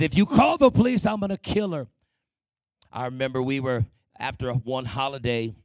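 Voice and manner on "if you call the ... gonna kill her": higher, louder menacing threat; father's threat. Retold or imitated. Father threatening the kids about calling police, higher, louder menace. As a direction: bark the threat like the raging father. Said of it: "and he says".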